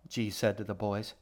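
ordinary delivery, nothing marked

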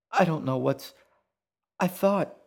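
high-pitched, bewildered mother; dazed broken-off thought; dazed, trailing off. A narrator's reading. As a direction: lose the thread in confusion. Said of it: "she trailed off, dazed".

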